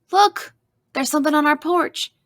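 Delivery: high, loud exclamation; surprised call. A narrator's reading. Mama spotting something on the porch, surprised call. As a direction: call out the sudden discovery, pitch leaping up. Mama suddenly calls out a discovery.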